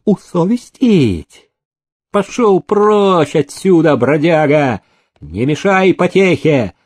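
mock the word scornfully; sneering. A narrator's reading. Master sneering at the bold dog-keeper, mocking. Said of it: "ехидно усмехнулся".

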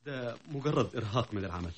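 shaken. Acted. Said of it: "أنا مضطرب نفسيًا".

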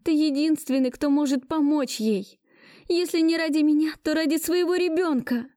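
high, pleading, slowed plea; begging. Acted. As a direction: implore him desperately. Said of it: "умоляла я".

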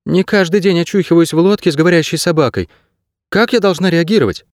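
put-on high-pitched girl's protest; indignant retort. A narrator's reading. Girl waking in a boat with a talking dog, retorting.

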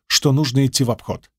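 ordinary delivery

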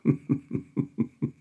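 a satisfied dull gurgle in the throat; dull chuckle. Acted. Wetschorowski ends his verses with a dull, satisfied chuckle. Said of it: "ein dumpfes Glucksen von sich gab".

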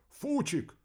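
high yell of the name again. Acted. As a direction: call out the name in alarm. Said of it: "заорал радист".

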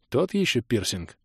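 usual speaking voice

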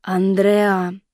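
usual speaking voice

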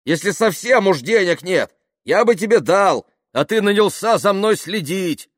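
loud, high-pitched reproach; angry yelling. Acted. Yuklin accusing Kaftanov of following him for hire, angry yelling. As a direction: hurl the accusation at full voice.

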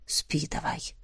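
low, barely voiced whisper. A narrator's reading. Man carrying the drowsy girl to bed, whispering. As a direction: whisper it to send someone to sleep. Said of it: "прошептал".

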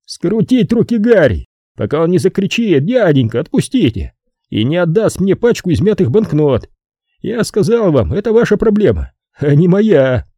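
high-pitched, put-upon man; high, testy character voice; irritated Brogan. Acted.

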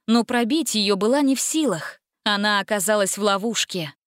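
usual speaking voice

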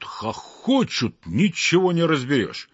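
usual speaking voice

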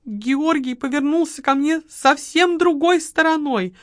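high, loud complaining woman; aggrieved widow. A narrator's reading. Widow complains to the marquis, high and loud. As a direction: pour out her grievances. Margarita's lament.

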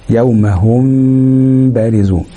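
slowly recited, with a long steady hum on the م of هم; ikhfa of meem. Shown as a demonstration. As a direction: stretch the م of هم nasally before opening into بارزون. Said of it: "وقلنا إزاي بنعمل إخفاء الميم".

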